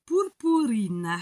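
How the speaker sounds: "purpurine" drawn out in a would-be Portuguese accent; very slow Portuguese word that sounds rather Italian; accent portugais italianisant. Shown as a demonstration. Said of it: "avec mon superbe accent qui fait plus italien qu'autre chose". (« purpurine » très étiré, accent portugais qui sonne italien)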